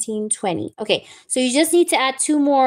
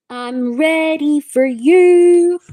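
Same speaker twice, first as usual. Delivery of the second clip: higher, louder, slow stretched-out line; drawn-out sing-song; 4 semitones higher, 4 dB louder, much slower than usual. Pretend. Reader teasing viewer she is ready, slow sing-song. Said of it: "teasing repeat to viewer".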